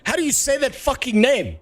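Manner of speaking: high-pitched exasperated question. Real, not acted. Streamer stuck on a name in the game text, exasperated. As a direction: plead in high frustration.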